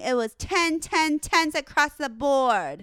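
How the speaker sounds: raving about the scores; excited. Real, not acted. Praising the dog's grooming, high loud excited rave. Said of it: "excited judging score".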